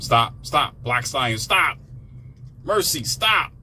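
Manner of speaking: ordinary delivery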